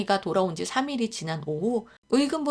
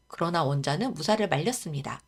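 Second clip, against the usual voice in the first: ordinary delivery, nothing marked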